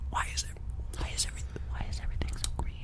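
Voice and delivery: hushed, voiceless whisper. Real, not acted. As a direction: whisper it quickly under your breath. Reaction after a drink.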